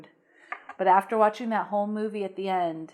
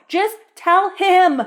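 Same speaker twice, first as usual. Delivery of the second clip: loud, slow, fed-up yell at the screen; exasperated shout; 9 semitones higher, 9 dB louder, slower than usual. Real, not acted. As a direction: shout it out of pure frustration. Exasperated at movie character.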